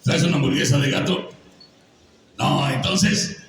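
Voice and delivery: lightly raspy alien puppet voice; a touch of the gruff Alf; the voice actor opens in his Alf voice, slightly raspy. Pretend. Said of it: "ya vieron el cambio de, de, de voz que hago".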